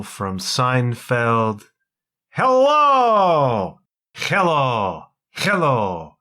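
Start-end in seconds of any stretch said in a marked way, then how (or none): 2.3-6.2 big high hello falling away; Uncle Leo hello. Retold or imitated. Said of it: "hybrid Inigo Montoya and Uncle Leo".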